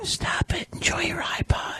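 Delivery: soft eerie demon whisper; scary demon voice. Pretend. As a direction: whisper like a tempting devil.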